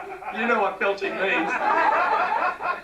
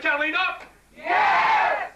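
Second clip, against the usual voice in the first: rallying shout; 7 semitones higher, much slower than usual. Real, not acted. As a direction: pump up the crowd. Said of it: "you sound like you're on the bloody Sydney".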